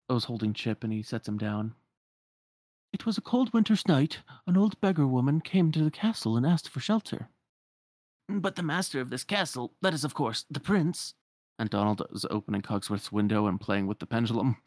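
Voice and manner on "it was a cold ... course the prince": higher, measured character storytelling; storytelling servant. Acted. Enchanted servant telling the rescuers the history, theatrically. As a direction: tell it like an old tale.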